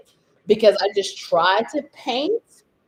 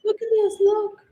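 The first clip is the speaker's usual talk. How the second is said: normal talking voice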